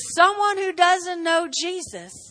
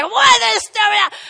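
loud, slow, higher yell at a driver; mock road rage; 8 semitones higher, 9 dB louder, much slower than usual. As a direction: bark at the other car in mock fury. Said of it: "when you're driving by".

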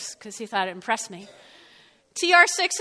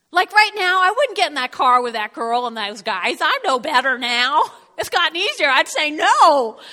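emphatic; 3 semitones higher, 8 dB louder than usual. Acted. Preacher speaking as her present self.

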